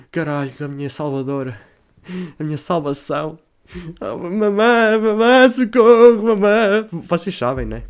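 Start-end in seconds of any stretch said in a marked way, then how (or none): 4.0-6.9 high loud crying call, drawn out slowly; mock sobbing call for mum; trembling plea. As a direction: whimper for mum like a hurt little kid. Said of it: "com a voz toda a tremer".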